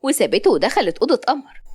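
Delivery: ordinary delivery, nothing marked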